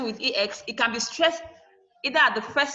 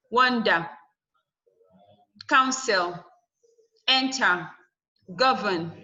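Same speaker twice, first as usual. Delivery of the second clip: Wonder, counsel, enter, govern, each stressed on the first syllable; teacher's word list, WON-der, COUN-sel, EN-ter, GOV-ern; slower than usual. Shown as a demonstration. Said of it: "they are stressed on the first syllable".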